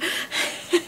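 a quick, high unvoiced laugh of disbelief; laughing at how absurd it sounds; breathless laugh. Real, not acted. At her friend's punched-in-the-eye story, disbelieving laugh.

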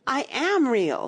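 high, slow, insistent protest; mildly upset insistence; tearful protest. A narrator's reading. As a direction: insist on it, stretching the words. Alice protests, about to cry.

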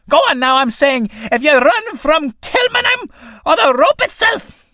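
high, loud, angry priest voice; a priest finally snapping and yelling; losing his temper. A narrator's reading. Priest threatening the tinker, loud and furious. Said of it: "Priest, losing his temper finally".

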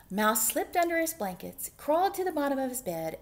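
ordinary delivery, nothing marked

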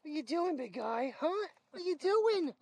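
lightly sing-song pet talk. Real, not acted. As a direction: croon at the big dog. Petting the dog.

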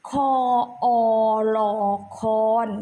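คอน with a long open ออ vowel; pronunciation demo. Shown as a demonstration. Teacher shows the vowel change to pupils, careful spelling aloud. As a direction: spell คอน piece by piece, stretching ออ. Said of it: "จะออกเสียงว่า".

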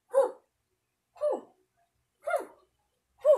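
short exhaled hu on each strike; kiai grunts. Shown as a demonstration. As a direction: snap out a hu per punch. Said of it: "So, pangalawa". (matinding hu sa bawat suntok)

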